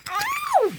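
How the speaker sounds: very high squealed "ow"; pained scream. A narrator's reading. Clara is hit by the coach, pained scream. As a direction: shriek it thin and high. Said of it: "screamed Clara".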